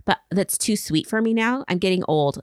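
usual speaking voice

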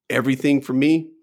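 ordinary delivery

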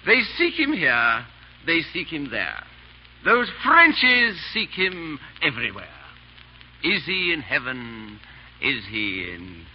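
drawn-out, measured recitation of one's own verse; verse recital. Acted. Fop performing his verse for Chauvelin, reciting. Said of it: "I want you to hear my verse".

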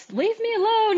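very high-pitched retold whine; petulant outburst. Upset fighter telling her husband to back off, mock petulant outburst.

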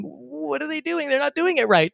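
mock-yell at a podcast doing it wrong; mock yell at the speaker. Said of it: "yelling at the speaker".

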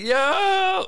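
high, loud crowd whoop; a mock club crowd yelling out loud. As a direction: cheer like a whole club shouting. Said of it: "And everybody like".

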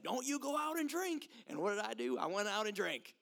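high-pitched hillbilly scolding and retort; hammed-up hillbilly twang; hillbilly accent. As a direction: put on a playful hillbilly voice. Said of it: "talking like a hillbilly right now".

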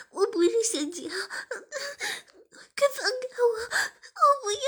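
high, loud crying protest; distressed high-pitched wail; struggling plea. Acted. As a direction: cry out in distress, pleading to be released. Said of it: "志敏挣扎着".

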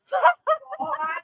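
high-pitched burst of laughter. Real, not acted. Laughing near the end of the stream, high laugh.

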